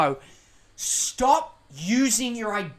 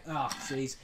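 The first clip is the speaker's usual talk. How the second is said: ordinary delivery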